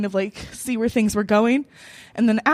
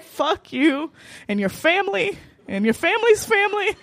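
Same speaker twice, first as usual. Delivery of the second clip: higher, comic cursing without extra volume; retold annoyance; 5 semitones higher than usual. Woman cursing her ex down the phone, annoyed. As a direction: pile up the curse in mock outrage. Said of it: "So annoyed".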